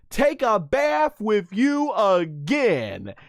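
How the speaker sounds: high, slow mock shout; passionate Bulma yell. Bulma shouting her threat at Vegeta, high slow mock yell. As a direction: throw the line out with passion. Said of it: "she yells that out with all her passion".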